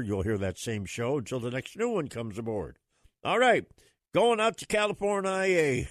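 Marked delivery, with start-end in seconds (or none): 3.2-5.9 loud, high call to open the segment. Real, not acted. Radio host calling out to the listeners. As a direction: sing out the segment change.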